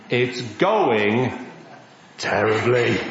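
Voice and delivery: low-pitched "terribly" after a pause; grave deadpan. Acted. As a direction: drop low and leave a gap before "terribly". Reveals the failed fishing trip.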